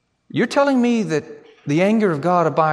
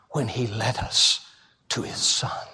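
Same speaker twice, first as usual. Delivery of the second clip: ordinary talk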